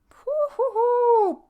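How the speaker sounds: long, loud high "woo-hoo-hoo"; high drawn-out whoop. Real, not acted. Exclaims at opening can of worms.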